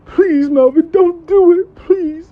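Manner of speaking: high, whiny mock pleading; imitated begging. Upset client apologising to Melvin, high pleading whine. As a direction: beg in a put-on high voice, dragging the last words.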